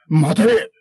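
loud, high shout. Acted. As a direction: yell after the fleeing monk. Samurai chases.

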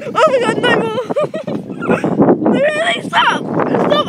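high, loud laughing plea to stop; real laughing fright, begging; laughing panic. Real, not acted. Begs swing pusher to stop, laughing.

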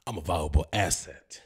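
ordinary talk, nothing marked

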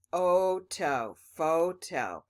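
"Photo." with long o sounds; correct long o. Shown as a demonstration. Teacher rereading the word with the class. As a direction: say "Photo." clearly, long o twice. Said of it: "Let's try to read it again".

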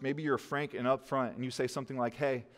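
normal talking voice

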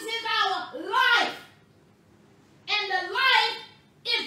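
high, loud, sustained sermon delivery; half-chanted, exclamatory preacher cadence; full-force preaching. Real, not acted. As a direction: drive it home, stretching the scripture words.

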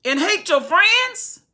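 high, loud, indignant outcry; accusing shout. Acted. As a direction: hurl the accusation at the king. Preacher voicing accusing soldiers.

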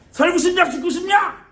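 high, loud mock threat; possessed menacing shout. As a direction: roar it like a spirit-possessed shaman, hammed up. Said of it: "눈 막 이렇게 신이 올랐어".

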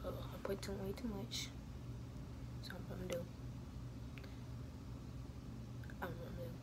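soft unvoiced whisper throughout; whispered vlog. Real, not acted. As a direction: keep it hushed as if someone sleeps nearby. Keeps voice down while dressing.

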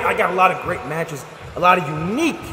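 ordinary talk

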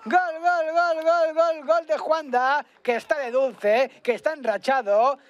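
long high shouted goal call; goal scream. Real, not acted. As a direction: belt out the goal. Second goal called.

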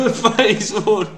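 laughing. Real, not acted. Laughing at own joke.

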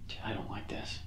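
nervous hushed whisper. Real, not acted. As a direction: breathe it out, uneasy. Uneasy at night in the woods.

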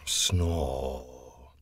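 low, soft snore; sleepy snore. Acted. Mister Lazy snoring in his hammock, low and soft. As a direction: drift back off with a snore.

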